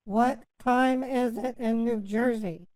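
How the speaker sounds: repeated slowly for a bad line; slow and clear. Real, not acted. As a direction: spell it out slowly. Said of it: "Can you please repeat it".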